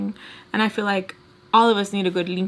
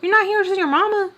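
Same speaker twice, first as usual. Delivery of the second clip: high, fast mocking imitation; imitating Barbara; 11 semitones higher, 4 dB louder, faster than usual. Replaying the woman's remark about mama, imitating her high voice. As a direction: pitch it way up and rattle it off. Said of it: "was her saying".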